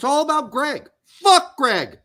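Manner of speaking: real anger, high and loud; fed-up outburst. Real, not acted. Podcast host ranting about the team coach, angry outburst. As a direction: blurt it out loud, sick of him.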